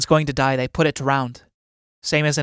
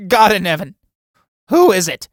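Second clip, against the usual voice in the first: higher, louder cry of shock; startled fright; 5 semitones higher, 9 dB louder than usual. Acted. Old man startled by the Mummy behind him, frightened. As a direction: jump out of your skin. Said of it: "jumping out of his skin".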